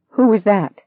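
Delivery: sudden high, loud panicked question; half hysterical. Acted. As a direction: blurt it out, close to hysteria. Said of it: "She sounded half hysterical".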